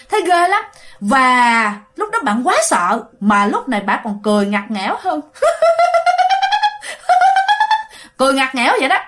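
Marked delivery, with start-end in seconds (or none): 5.3-7.8 shrill ghostly cackle, far higher than the voice; eerie high laugh. Retold or imitated. Teller imitating the possessed woman's ghostly cackle. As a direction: let out a long spooky ghost laugh.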